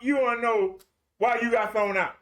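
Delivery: usual speaking voice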